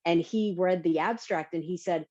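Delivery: ordinary delivery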